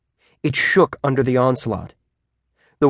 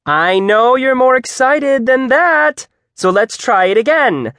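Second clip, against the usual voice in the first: peppy shout; 12 semitones higher, 6 dB louder than usual. A narrator's reading. Teacher pushing groaning students for more, shouting high. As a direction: shout like an over-eager cheerleader. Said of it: "she shouted".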